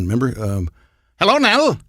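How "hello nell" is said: high-pitched cartoon villain; oily old-time villain greeting the heroine, pitched way up; Snidely Whiplash. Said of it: "but the other villain".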